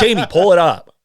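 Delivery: loud commanding call to Jamie; Joe Rogan impression. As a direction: bark the request. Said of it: "Joe Rogan".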